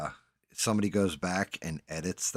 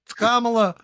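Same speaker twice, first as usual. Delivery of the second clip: mock surprise; 13 semitones higher, 8 dB louder than usual.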